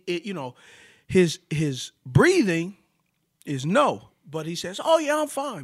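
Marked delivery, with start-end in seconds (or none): 4.8-5.6 imitating a higher, falsely cheerful brush-off; pretending to be fine while winded; fake breezy. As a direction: play it off like nothing's wrong. Neighbor hides exhaustion, forced cheer.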